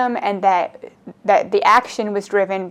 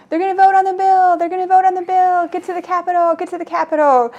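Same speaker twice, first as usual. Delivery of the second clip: imitated yelling; 9 semitones higher than usual. Bikers alerting people walking to the Capitol, imitated yelling. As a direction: call it out like bikers spreading the alarm. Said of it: "yelling about the bill".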